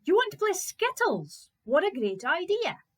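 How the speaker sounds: high, loud, eager exclamation; delighted Fritz. Acted. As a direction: greet the game with great enthusiasm.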